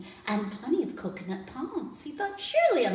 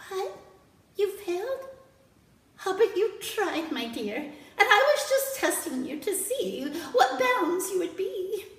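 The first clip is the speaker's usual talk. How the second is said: higher princess, lightly amused; 9 semitones higher, 5 dB louder than usual. Acted. Princess forgives the king, high and amused. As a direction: reveal the test, forgiving.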